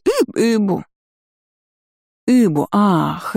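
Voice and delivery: Ыбу with no R, stumbling; dropped R. Acted. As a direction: stumble over Ыбу, R missing. Gargoyle fails the R. (Ыбу без Р, запинаясь)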